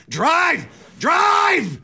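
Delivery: very high road-rage shout. Retold or imitated. Host reliving shouting in the car, road-rage shout. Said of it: "I'll just be shouting".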